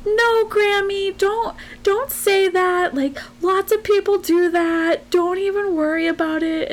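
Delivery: reassuring pep talk. Retold or imitated. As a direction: gently talk Grammy down, a touch imitated. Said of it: "gave her the greatest pep talk".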